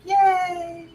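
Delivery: high, drawn-out happy "yay"; retold little squeal of joy; delighted cheer. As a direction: cheer as if a bad day just turned good. Retelling her joy at sunflowers.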